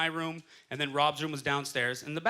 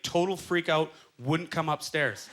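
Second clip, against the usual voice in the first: ordinary delivery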